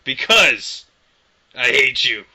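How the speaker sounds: loud mock yell of hate. Yelling a joke insult at the friend on the phone.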